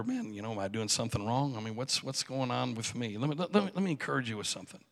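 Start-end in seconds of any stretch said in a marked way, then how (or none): 0.0-3.2 low, soft self-doubt; subdued, puzzled inner voice; quietly wondering. Acted. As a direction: question yourself under your breath. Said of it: "we start to wonder".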